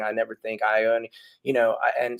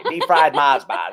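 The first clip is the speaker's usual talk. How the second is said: mock Aussie accent; 2 semitones higher, 6 dB louder, slower than usual. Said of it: "the extent of my Australian".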